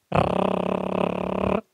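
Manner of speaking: long, low rolling trill like a motor; motorboat lip trill. Shown as a demonstration. Trainer demonstrating the breathing drill, motorboat trill. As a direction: trill steadily on one breath. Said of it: "Motorboat kayak gini, tarik nafas".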